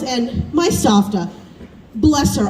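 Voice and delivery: ordinary talk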